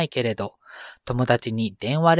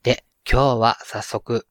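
ordinary talk, nothing marked

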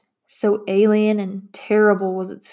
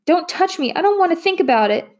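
much higher, fast panicked shriek; 9 semitones higher than usual. A narrator's reading. Maria shrieks at Matt to not touch her. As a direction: shriek it out in terror. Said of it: "she shrieked".

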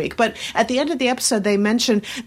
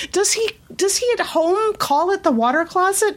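high, amused, disbelieving question; incredulous question; 11 semitones higher than usual. Retold or imitated. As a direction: ask it as if that can't be true. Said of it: "they were just like".

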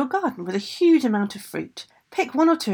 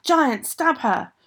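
slow, higher storybook command; infuriated call; 5 semitones higher, 4 dB louder, much slower than usual. A narrator's reading. Ogress orders giant to stab, infuriated call. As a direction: draw out the furious order. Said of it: "Infuriated, the Mama Draga called out".

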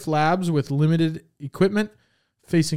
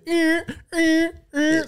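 high nasal alarm noise, much higher; alarm beeps; slower than usual. Pretend. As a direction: mimic a lab alarm going off. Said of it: "I'm gonna do some sound effects".

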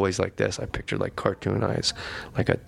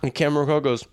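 usual speaking voice

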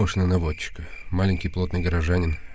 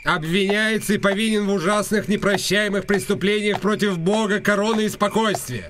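town crier; 13 semitones higher than usual. Acted. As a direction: call it out like a herald on the square. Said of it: "прокричал, подражая глашатаю на Королевской площади". (высоко и протяжно, как глашатай на площади)

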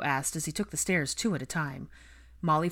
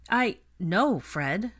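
emphatic start, settling on the name; firm; 5 semitones higher, 5 dB louder, much slower than usual. A narrator's reading. Hermione reaches to take her son back, firmly. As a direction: put your foot down firmly. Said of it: "Hermione said firmly".

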